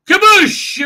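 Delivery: very high, loud mouthed kaboom; explosion sound. Retold or imitated. Borg Queen firing on starships: loud mouthed kaboosh. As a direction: shout the blast like a cartoon.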